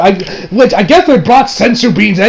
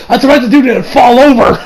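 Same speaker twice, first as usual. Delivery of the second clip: ordinary delivery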